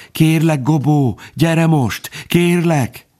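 high, strained begging; thin, frightened appeal; desperate pleading. A narrator's reading. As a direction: beg as if barely able to speak. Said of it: "aki már alig tudott szólni, suttogta".

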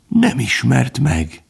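drawn-out, faltering appeal; voice breaking. A narrator's reading. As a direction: let the voice give way as you say it. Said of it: "Hangja megtört".

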